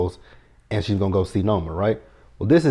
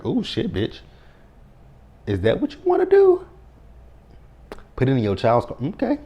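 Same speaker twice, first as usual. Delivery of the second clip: much higher, sassy disbelief at the screen; mock outrage; 6 semitones higher than usual. Vlogger mocking Monet's choice, sassy disbelief. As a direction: scoff at her like a shocked friend. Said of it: "I was like".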